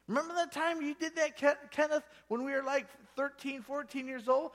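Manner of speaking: put-on high, teasing voice of an old friend; friend teasing Hagin. Old friend ribbing Hagin about picking locks, high and teasing.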